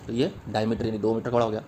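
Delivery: ordinary talk, nothing marked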